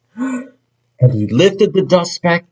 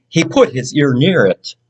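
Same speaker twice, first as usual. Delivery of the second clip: ordinary delivery, nothing marked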